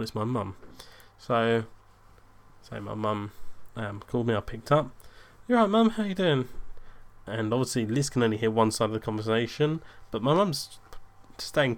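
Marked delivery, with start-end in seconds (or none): 5.5-6.5 high, loud opening then easing slightly; phone greeting. Retold or imitated. Son picking up his mum's call, high phone greeting. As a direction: answer the phone in a lifted, raised pitch.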